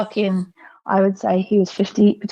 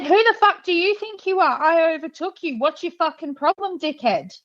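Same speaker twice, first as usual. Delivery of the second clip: retold angry retort; 8 semitones higher than usual. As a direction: snap back pitched high, then let it drop. Retold shouting back at driver.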